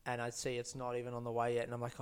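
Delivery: normal talking voice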